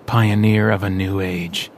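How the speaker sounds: slow, measured reading aloud; slow deliberate recital; reading an inscription. Acted. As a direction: read the tomb's words slowly. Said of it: "Vincent read".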